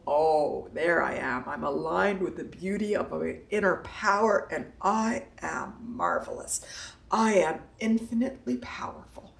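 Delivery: hushed reverent self-affirmation; soft affirmation. Pretend. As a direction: savor each line of self-love. Affirmations in inner voice.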